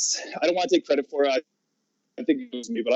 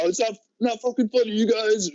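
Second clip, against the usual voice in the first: pitched-up imitation of an angry friend; mock his fed-up complaint, higher; pissed off; 6 semitones higher than usual. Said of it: "he's super pissed".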